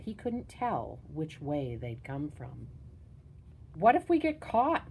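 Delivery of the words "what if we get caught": high, anxious question, not truly whispered; nervous. A narrator's reading. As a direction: raise the pitch in a worried boy's voice. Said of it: "whispered Thomas nervously".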